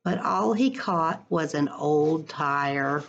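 expressive picture-book read-aloud; reading to children. Acted. Teacher reading a picture book to her class, lively storytime voice.

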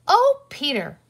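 high, loud exasperated cry. A narrator's reading. Girl reacts to her brother wanting cars, crying out. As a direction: exclaim in dismay at a little brother. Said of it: "Penny cried".

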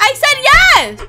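high, loud, slow insistence; exasperated shout. Real, not acted. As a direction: yell it slowly, drawing it out. Game repeats the question.

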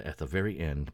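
ordinary talk, nothing marked

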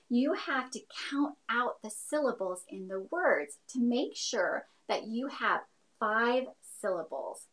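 ordinary talk, nothing marked

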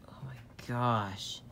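ordinary talk, nothing marked

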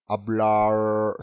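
"hablar" held long with an English-like O-coloured ending; faulty English-style sound. Shown as a demonstration. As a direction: isolate the bad ending of "hablar" and stretch it. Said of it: "そのOもおかしいと思います". (「hablar」の語尾を英語風に伸ばす)

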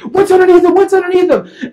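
high, loud frantic demand; police freaking out. Retold or imitated. Police spotting the duffel bag, freaking out. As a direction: yell the question over and over in panic. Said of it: "police start freaking out immediately".

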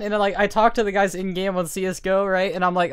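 usual speaking voice

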